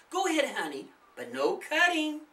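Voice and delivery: a touch of a warning mom; mother allowing but cautioning; mild warning. Acted. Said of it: "the woman warned".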